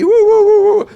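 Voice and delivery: high loud giddy woo woo; nervous woo. Retold or imitated. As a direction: whoop like a jittery traveller. Mimics nervous excitement at immigration.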